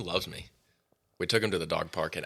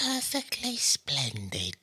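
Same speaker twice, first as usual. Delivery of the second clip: slow, higher prim child imitation; little girl voice; 7 semitones higher, slower than usual. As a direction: echo the prim ghost girl. Echoes the girl's line.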